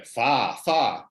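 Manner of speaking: FAR with no R, ending on an open "ah"; FAR, R silent, vowel left open; non-rhotic FAR. Shown as a demonstration. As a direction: say "far" Australian-style, dropping the R. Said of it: "at the end of words".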